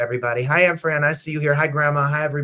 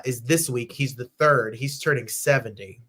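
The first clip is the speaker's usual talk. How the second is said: usual speaking voice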